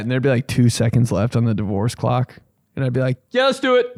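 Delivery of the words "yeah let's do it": excited. Acted. Acted eager quote.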